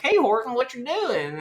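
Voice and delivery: high little kid voice, lightly put on. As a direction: pitch up like a small child. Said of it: "they're like".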